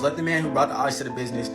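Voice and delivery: normal talking voice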